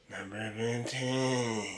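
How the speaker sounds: low, slow, soft and strained countdown; mock narrator. Reactor joking the narrator is on the toilet, drawn-out mock-narrator voice. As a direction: draw it out quietly as if straining on the toilet. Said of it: "he might be on the toilet".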